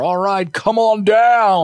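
high game-show host call, slow; hype host intro. Acted. Podcast host welcoming listeners, hyped announcer call. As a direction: bring them on like a host.

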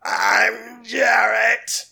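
slow ghostly shout, laughing; spooky shout. Acted. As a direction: howl the name like a ghoul. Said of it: "very spooky".

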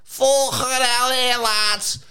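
hammed-up Scouse, starting high, loud and slow; terrible Scouse accent. Mimicking the game show host for co-host, terrible Scouse accent. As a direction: do a badly overdone Scouse presenter. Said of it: "That is the worst Scouse accent I've heard".

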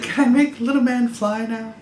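put-on high, childlike pleading voice; high-pitched little kid; little Robert. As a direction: put on a small boy asking permission. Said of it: "Robert said".

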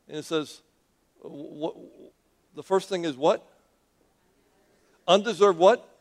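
usual speaking voice

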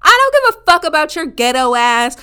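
loud snapping comeback; angry retort. Retold or imitated. Cursing out the ex who keeps calling, loud. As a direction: bite it out at him. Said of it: "And I'm like".

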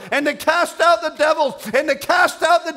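very high, loud commissioning shout; full-force preaching. Real, not acted.

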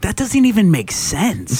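high-pitched, drawn-out disbelief; exasperated. Retold or imitated. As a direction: stretch it out in retold frustration. Retold exasperation at nonsense answer.

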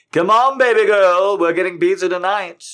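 shrill excited repeat; over-the-top hype. Acted. Repeat higher.

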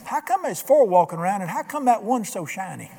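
a king who can't believe his eyes; lightly voiced amazed king; astonished king. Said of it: "He looked at me and said".

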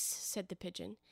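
ordinary delivery, nothing marked

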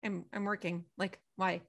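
put-on a boyfriend muttering back softly to his girlfriend; bored brush-off. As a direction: shrug it off quietly. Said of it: "he's just like".

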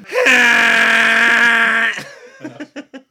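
loud high held foghorn roar; T-Rex foghorn roar. Pretend. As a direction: blare it like a dinosaur foghorn. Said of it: "what's the T-Rex foghorn one".

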